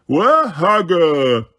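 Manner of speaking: very slow giant question; giant asking. A narrator's reading. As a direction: drag each word like a giant. Giant asks for Hagrid.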